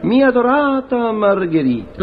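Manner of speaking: slow, hammed tragic lover; drawn-out dramatic address. Actor begins the tragic scene, slowly and hammed up. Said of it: "Io incomincio".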